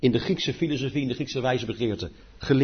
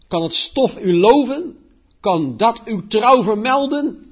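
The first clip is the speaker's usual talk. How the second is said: solemn, raised psalm reading, slowing on the pleas; scripture reading; 5 semitones higher, 4 dB louder than usual. Acted. Preacher reads the psalm aloud to the congregation, solemn reading. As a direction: read it like a preacher lifting the psalm up.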